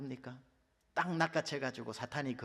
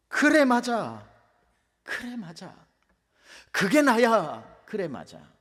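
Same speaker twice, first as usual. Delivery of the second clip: 1 slow loud agreement; 7 semitones higher, 9 dB louder, slower than usual. Acted. Acting out self-agreement.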